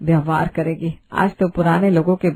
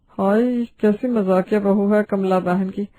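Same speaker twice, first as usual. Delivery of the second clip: plain reading voice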